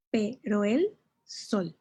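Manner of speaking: pero el sol stretched out, pero and el joined in one glide; slow, deliberate verse counting; slow syllable count. Shown as a demonstration. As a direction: spell out the beats slowly. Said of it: "Serían tres sílabas porque hay una sinalefa".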